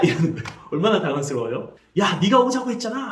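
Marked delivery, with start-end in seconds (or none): 2.0-3.1 high, louder mock scolding; mother snapping at her child; mother angry at the restaurant, imitated. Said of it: "하면서 엄마가 막 화를 냅니다".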